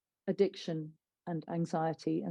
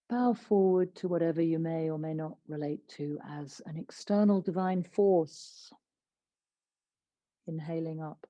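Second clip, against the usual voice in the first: guided meditation. Acted. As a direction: ease them into stillness.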